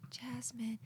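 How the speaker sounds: hushed unvoiced name; whisper. Real, not acted. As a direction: breathe it like a secret. Whispered aside.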